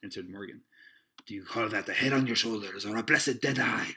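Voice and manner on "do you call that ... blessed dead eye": loud, scolding seaman's voice; cried. A narrator's reading. Long John berates Morgan, crying out. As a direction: berate him in a rolling sailor voice. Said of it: "cried Long John".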